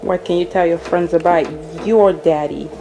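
usual speaking voice

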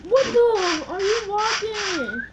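high startled questions; surprised. Real, not acted. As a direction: gasp out the questions in shock. Startled by walking player.

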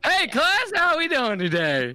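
a hint of a teacher greeting a Zoom class; cheery teacher. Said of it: "playing the teacher".

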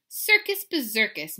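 plain reading voice

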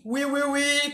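light mock French "we, we, we"; playful French-ish "we, we, we"; French-sounding we. Shown as a demonstration. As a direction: say "we" like a little Frenchman's oui. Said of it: "Parece um, um francesinho".

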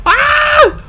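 very high, loud scream; startled scream. Real, not acted. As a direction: scream out in sudden alarm. Startled, gets stuck.

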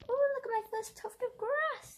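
high delighted squeal; light up, pleased with herself. Real, not acted. Delighted at her own result.

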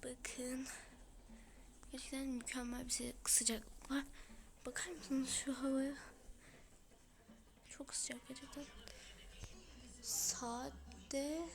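hushed, mostly whispered, with a sore throat; sick whispery voice. Real, not acted. A sick kid greets her YouTube viewers in a whisper. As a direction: talk through a sore throat, barely voicing. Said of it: "sesimden de anlaşıldığı gibi hastayım".

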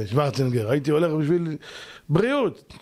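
ordinary delivery

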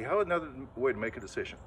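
ordinary talk

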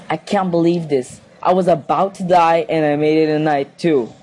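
ordinary talk, nothing marked

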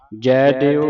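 ਜੈ ਦਿਓ with a clear open ai in ਜੈ; careful vowel demo. Shown as a demonstration. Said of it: "ਜੱਜੇ ਦਲਾਵਾਂ ਲੱਗੀਆਂ".

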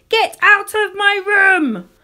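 put-on high, drawn-out child's yell; shouted order from a cross small child; indignant shout. A narrator's reading. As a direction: holler it slowly, high and loud. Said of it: "So I shouted".

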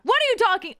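high, loud protest; indignant outburst. Real, not acted. Streamer denying her voice crack, loud indignant protest. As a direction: jump up in pitch, affronted.

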